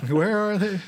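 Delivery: high, laughing mock panic. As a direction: giggle through the question. Laughing mock key search.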